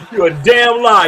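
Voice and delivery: laughing it out loud, calling a bluff; a real laugh through the words; amused, loud and incredulous; laughing disbelief. Real, not acted. Laughs at friend's claim.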